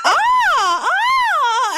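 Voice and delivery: high, loud mock shrieks with a laugh; mock delighted shriek. [name] and [name] over the closet camera, mock shrieking. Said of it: "hovering over the camera going".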